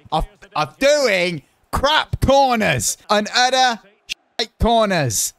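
high, loud, slow groan at his corners; mock self-scolding. Real, not acted. Gamer confessing to viewers his bad corners, slow mock-exasperated groan. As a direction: exaggerate the frustration. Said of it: "I've got a bit of an addiction".

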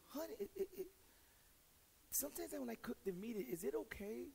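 timid wife. Retold or imitated. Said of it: "she goes".

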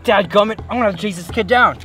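loud sheriff. Acted. Sheriff swears, gives chase.

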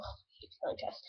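breathy groaning mutter; whispered grumble. Real, not acted. Muttered complaint, unvoiced.

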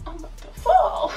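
high yelp breaking into a giggle; laughing yelp. Real, not acted. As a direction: squeal while losing balance. Wobbling in tight outfit.

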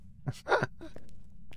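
high unvoiced mock ghost cackle; high spooky laugh. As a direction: let out a thin eerie giggle. Laugh after spooky noise.

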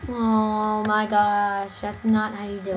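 low, soft, very slow groaned-out correction; mock dismay. Friend rejects the other's teacher imitation, slow low mock disappointment. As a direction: drag it out as if deeply let down.

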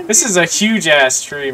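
ordinary talk

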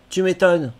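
normal talking voice

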